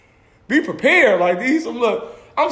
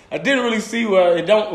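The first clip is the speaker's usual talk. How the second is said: ordinary delivery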